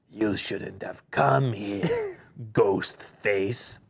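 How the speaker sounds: slow, low gruff cultist; Will Arnett voice. Acted. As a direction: drag the words out menacingly. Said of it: "you do a pretty good Will Arnett".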